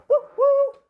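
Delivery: high, loud "woo, woo"; train whistle hoot. Retold or imitated. Host joking about the Trump train, high whistle hoot.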